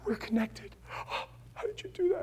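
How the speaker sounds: gasping, high, soft mock sobs; imitated weeping. Preacher imitates woman weeping at magic trick, gasping sobs. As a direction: imitate sobbing between gasps for breath. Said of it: "She was weeping".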